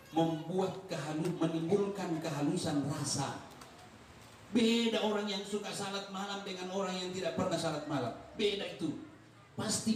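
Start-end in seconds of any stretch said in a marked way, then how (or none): none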